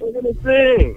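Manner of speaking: high-pitched, eager burst; fired up, pitched well up; excited rallying. Real, not acted. Caller announces his crew will sing for the host, excited.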